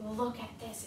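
ordinary delivery

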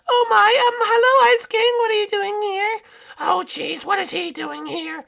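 very high put-on princess voice; high-pitched cartoon princess, taken aback; startled princess. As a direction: squeal it like a flustered princess. Voices startled princesses seeing Ice King.

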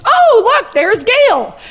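high, bright-eyed innocent remark; retold innocent delight; innocent exclamation. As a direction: point someone out in all innocence, pitched high. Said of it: "Susie very innocently says".

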